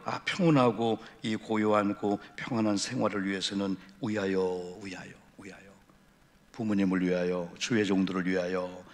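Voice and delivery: low, soft, measured appeal; hushed, solemn preaching. Real, not acted. Preacher calling the congregation to live for others, hushed and solemn. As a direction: let the voice sink into quiet reflection.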